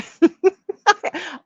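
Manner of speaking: a big high laugh that bursts out; loud delighted laugh; burst of laughter. Real, not acted. Laughs at the joy dance homework.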